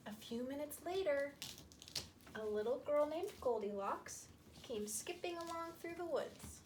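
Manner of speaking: plain reading voice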